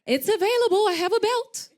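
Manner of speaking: high, loud, playful threat; mock-tough comeback. Replying to castmate who says they need love, mock-threatening. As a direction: belt it out like comic tough love.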